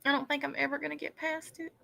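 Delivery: very soft, quick, fading murmur; faint mock despair. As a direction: let the pretend heartbreak fade almost away. Mock heartbreak, repeated even softer.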